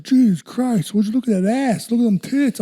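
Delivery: high-pitched mock astonishment; crude friend impression. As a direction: exclaim it high, imitating a gawking buddy. Imitates friend's crude outburst.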